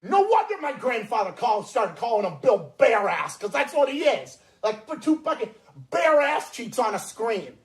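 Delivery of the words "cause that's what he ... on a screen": ordinary talk, nothing marked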